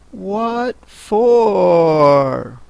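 put-on high, drawn-out child heroine; Alice, puzzled. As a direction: stretch the question in a girlish voice.

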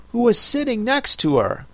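ordinary delivery, nothing marked

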